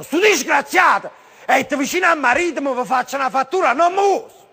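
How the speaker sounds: high-pitched comic tirade; mock-furious family rant; acted scolding.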